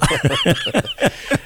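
big high laugh at his own punchline; real laughter after the punchline. Real, not acted. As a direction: crack up at the joke.

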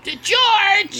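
high, loud drawn-out call; hammed-up nagging mom yell; George's mom. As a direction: screech it like a sitcom mother. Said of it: "the impression that [name]'s doing, making it sound like George's mom".

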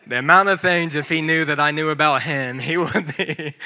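loud laughing confession of a fan crush. Real, not acted. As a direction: gush and laugh at once. Laughing confession about pastor fandom.

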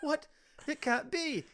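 high-pitched mock disbelief; mock shock. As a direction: act stunned by bad news. Acting shock at the bad news.

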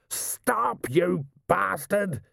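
high, drawn-out yell held back in volume. A narrator's reading. Rider screaming at a runaway camel, yelling. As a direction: stretch out a high, strained mock yell. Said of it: "he yelled".

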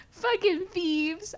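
high, drawn-out indignant wail; hammed-up outrage, pitched high and slow; mock-mad whine. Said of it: "I was so mad".

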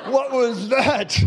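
slow, baffled laugh on the words; laughing what was that; stunned. Retold or imitated. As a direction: say it half-laughing in disbelief. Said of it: "I'm like".

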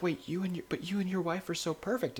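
a mock astonished question; disbelief. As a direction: act out his stunned surprise. Gavin's disbelief, retold.

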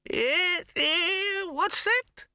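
put-on high, quavering old wizard; creaky doddering old man, high pitched. Poons voice, hard of hearing.

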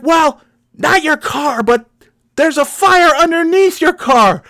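high, slow, fussy correction; pedantic stranger. Retold or imitated. Stranger corrects the driver about the fire, high fussy voice. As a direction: correct him like a know-it-all.

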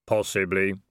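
sombre, even answer on a falling tune; Death. Acted. Robed figure answers Vimes, solemn Death voice. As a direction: say it as a level, solemn pronouncement.

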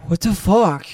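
high, loud startled exclamation; shocked what the fuck. Retold or imitated. Friend reacts to men checking out truck, startled. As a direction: blurt it out in disbelief.

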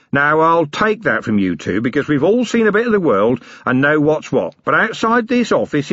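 a blustering self-made man playing host; cheeky boss offering coffee, tea, something stronger; Harry King rough boss. Harry insists on title.

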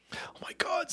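breathy aside under the breath; soft whispered "oh my God". Real, not acted. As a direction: whisper it in disbelief.

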